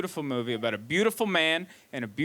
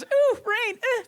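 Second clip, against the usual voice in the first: very high, loud squeal; imitated film heroine; 5 dB louder, much slower than usual. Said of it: "And she goes".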